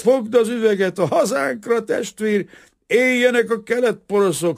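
booze-happy, sloppy cheer; drunk worker. A narrator's reading. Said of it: "a részegek bambaságával". (részeg, hangos, vidám ácslegény)

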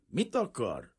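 a touch of unfriendliness; unfriendly. A narrator's reading. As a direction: ask it with little welcome. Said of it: "kérdezte nem éppen barátságosan".

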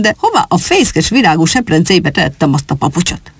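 plain reading voice

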